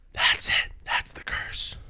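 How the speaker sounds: hushed realization, barely voiced; whispering. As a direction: whisper it like a discovery.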